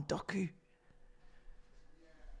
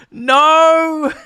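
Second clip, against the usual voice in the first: high, loud cry of no; loud shout; 12 semitones higher, 12 dB louder than usual. Real, not acted. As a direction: bellow it in despair. Reacting to the tackle.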